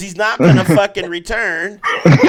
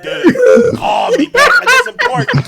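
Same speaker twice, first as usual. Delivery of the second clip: much higher, loud, urgent pleading; high mock friend; 3 dB louder than usual. Friend begging him to call back from work, high urgent voice. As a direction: squeak it out like a pushy friend. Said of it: "He's like".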